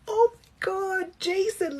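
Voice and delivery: high-pitched, squealing mock delight; put-on excited squeal; gushing greeting. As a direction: gush like greeting a long-lost friend. Gushing fake greeting to celebrity.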